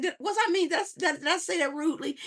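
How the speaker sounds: high, stammered worried questioning; high-pitched flustered self-doubt; acted anxious second-guessing. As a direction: trip over the words in mock worry. Acting out her anxious overthinking.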